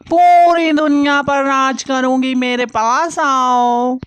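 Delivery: witch. Acted. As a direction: drag each word out like a gloating witch. Witch reveals herself at graveyard.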